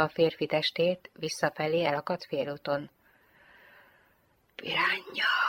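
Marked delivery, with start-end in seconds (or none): 4.5-5.3 breathy whispered name, much softer. A narrator's reading. Jane in the dark bed to the lieutenant, whispering. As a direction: breathe the word with no voice.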